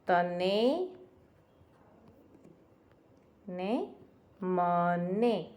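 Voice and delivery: very slow, drawn-out તને and મને; slow rhyme demo. Shown as a demonstration. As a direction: stretch each word for young pupils. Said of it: "છેલ્લો શબ્દ સરખો આવ્યો".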